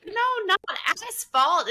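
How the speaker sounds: high, loud retold protest; exasperated correction. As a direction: correct the mishearing, pitched well up. Retells correcting a misunderstanding bartender.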